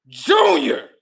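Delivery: high, loud yell of the name; shouting Junior. Retold or imitated. Father reacting to the pregnancy, a loud high yell. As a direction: holler his name in shock.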